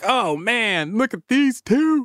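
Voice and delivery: high, slow, starstruck gush; awed gawking. Retold or imitated. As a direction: gush over the photos, drawn out. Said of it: "That's how I'm gonna sound?".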